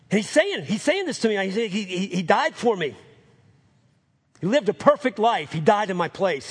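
high, emphatic, stammering fervour; fervent testimony. Retold or imitated. Man in hospital telling Mike he's saved, fervent. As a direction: testify with rising force.

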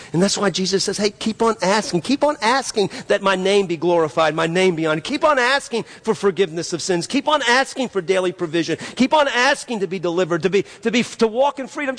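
building pulpit refrain, pitched high; insistent, impassioned exhortation; fervent preaching. Acted.

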